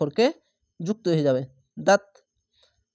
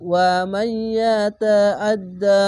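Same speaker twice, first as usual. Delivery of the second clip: louder, chanted Quran recitation with a merged nasal sound; full recitation; 3 semitones higher, 4 dB louder, much slower than usual. Shown as a demonstration. Teacher joining the words into a full chanted recitation. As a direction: recite it out as in prayer, drawing it out. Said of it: "ইদগামে বা গুন্না".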